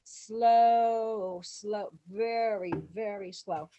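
long stretched "slow", easing down; slow coaching. Retold or imitated. Director coaching extras to dance slow, drawn out. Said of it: "he's instructing the same thing".

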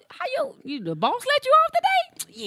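asking mama. Retold or imitated.

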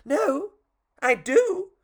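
very high puppet voice; little puppet. Acted. Puppet protesting to the fairy, very high voice.

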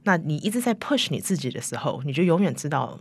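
ordinary delivery

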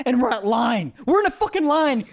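loud, climbing comic outburst; exasperated rant. Acted. Comic fed up with strangers in a checkout line, exasperated rant. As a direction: build to a high indignant yell.

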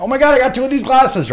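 high, loud gushing voice of a thrilled lady; excited squeal. Retold or imitated. A lady showing off stolen glasses to her friend, high excited gushing. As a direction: gush it out like a delighted customer.

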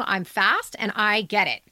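ordinary talk, nothing marked

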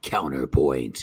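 very low, slow put-on voice; exaggerated low, slow delivery; mock-deep echo. Mock echo of another's "Counterpoint.

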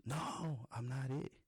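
put-on high, drawn-out child's protest; protesting little girl. Daughter protesting after being punched.

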